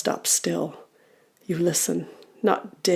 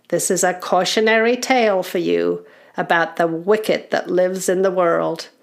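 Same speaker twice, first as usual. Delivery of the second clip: ominous elder goblin, raised then settling; goblin uncle; 4 semitones higher, 3 dB louder than usual. Acted. Goblin uncle introducing a terrible tale, ominous elder goblin. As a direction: warn the young ones like a storyteller. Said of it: "says the uncle".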